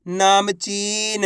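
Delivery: नामचीन very slow, with long ची; syllables blended slowly. Shown as a demonstration. Teacher joining letters for learners, नामचीन drawn out. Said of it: "तो मिलके बन जाएगा".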